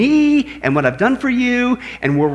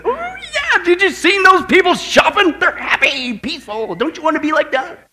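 laughing, much higher hammed-up enticing voice; preacher voicing the enemy luring with a laughing, much higher mock-excited voice; mock-excited tempter; 11 semitones higher than usual. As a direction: sell the lie with fake glee. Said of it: "he'll sit there and go".

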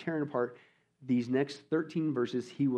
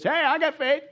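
much higher and louder, showy claim of faith; mocking fake believer; 11 semitones higher, 7 dB louder than usual. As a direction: ham up a loud hollow profession. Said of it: "and they say this".

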